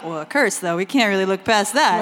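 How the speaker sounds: mock solemn king. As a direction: drop low and pompous, playing it dead serious. Kings voiced taking curse seriously.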